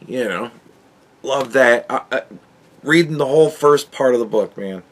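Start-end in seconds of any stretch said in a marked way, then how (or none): none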